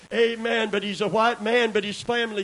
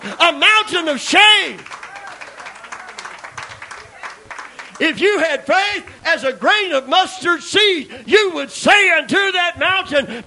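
high, loud, ringing sermon climax; the peak of the sermon, preached loudly to the congregation; preaching at full force; 7 semitones higher, 9 dB louder than usual. Acted. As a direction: shout it to the back pews with fire.

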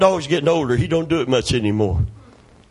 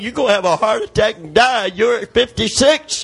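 laughing warning; 6 semitones higher than usual. Retold or imitated. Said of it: "And I'm thinking".

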